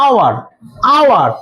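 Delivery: "আওয়ার" repeated, each opening on the vowel; silent H. Shown as a demonstration. Teacher showing which sound hour starts with, "আওয়ার" with silent H. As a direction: repeat "আওয়ার" without any H, falling at the end. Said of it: "কিসে উচ্চারণ হচ্ছে?".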